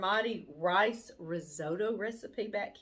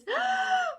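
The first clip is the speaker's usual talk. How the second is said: sharp high gasp of delight; 5 dB louder than usual. Real, not acted. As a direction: gasp out loud at something adorable. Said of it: "I gasped when I pulled this out".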